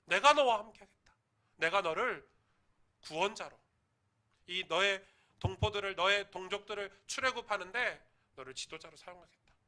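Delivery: loud, rising proclamation; booming God's promise. Retold or imitated. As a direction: thunder it from the burning bush. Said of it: "떨기나무에서요". (우렁차게 약속하는 하나님 목소리)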